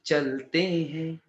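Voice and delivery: very slow, low, stretched vowels; drawn-out words. Pretend. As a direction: stretch both words right out, lazily. Teacher drags out moving on. (बहुत धीरे, खींचकर)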